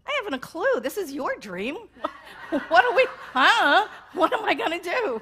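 high, amused monster laughing through it; mock monster chuckling at the dreamer. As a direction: play the monster, amused and shrugging. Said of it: "the monster leans in and says".

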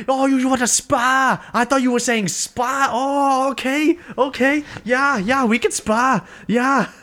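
very high, hammed-up Welsh accent; Darren Till impression. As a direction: play a sudden realisation in squeaky mock Welsh. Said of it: "I can't do a Welsh accent".

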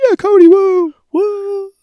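high, loud whooping cheer; a squad hamming up a celebration; mock cheering. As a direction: cheer Cody on like a rowdy squad. Crew cheering for Cody.

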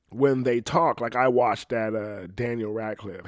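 ordinary talk

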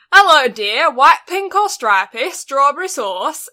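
put-on northern lady's accent. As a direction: sing it out like the northern ice cream lady. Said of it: "she talked like this".

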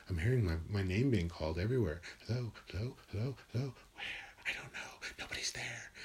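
hushed, eerie voice fading down to a whisper; crazy act. Acted. Comic doing a crazy act for drunk partygoers, fading to a whisper. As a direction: play a paranoid man hearing voices, dropping to a whisper. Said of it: "do some sort of crazy act".

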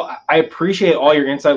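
ordinary talk

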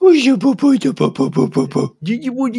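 high, loud babbling coo; mock baby talk. Host imitating being talked to like an infant, hammed-up baby talk. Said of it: "makes me actually feel like a child".

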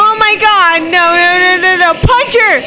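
loud cheering shout. Real, not acted. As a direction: yell it at the screen. Urging on a fight scene.

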